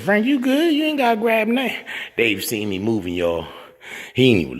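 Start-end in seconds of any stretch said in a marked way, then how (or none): 0.0-1.8 mock concern. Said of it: "I said".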